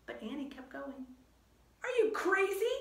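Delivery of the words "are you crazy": high, loud called question; high, loud exasperated call. Acted. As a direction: call after her in disbelief. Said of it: "Jack called".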